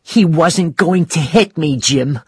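mildly acted tearful voice; tearful protest. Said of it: "as she cried".

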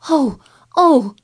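high, loud cry of joy; delighted cry. A narrator's reading. Sick boy hearing Dickon will come, crying out in delight. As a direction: burst out with delight. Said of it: "Colin cried out in delight".

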